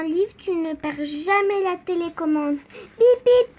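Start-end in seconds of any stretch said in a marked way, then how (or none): 3.0-3.5 high, loud beeping sound; playful beeping noise; mock beep. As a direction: beep like a remote. Imitating remote beeping.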